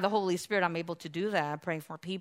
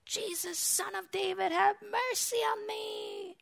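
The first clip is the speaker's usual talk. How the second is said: very high, drawn-out plea; acted beggar calling for mercy; imitated desperate shout; 13 semitones higher, 3 dB quieter than usual. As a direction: stretch the cry out high, without full volume. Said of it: "he shouted".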